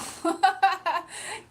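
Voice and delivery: high burst of laughter; loud laugh. Real, not acted. Streamer laughing at her own tears, loud laugh. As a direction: laugh it off loudly.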